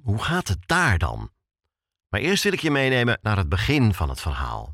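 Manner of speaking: ordinary talk, nothing marked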